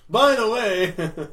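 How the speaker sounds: high, loud, slow drawn-out mimicry; mock eager call-out. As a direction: sing it out high and loud, mocking. Continues the mocking retelling.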